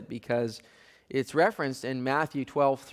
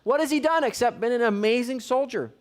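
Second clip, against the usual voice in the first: much higher, strained protest; pleading son; 10 semitones higher, 3 dB louder than usual. Acted. Jonathan pleading with his father Saul, much higher and disbelieving. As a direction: cry out in disbelief at your father. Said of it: "Jonathan's like".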